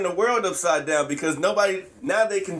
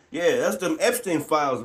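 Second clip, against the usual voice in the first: normal talking voice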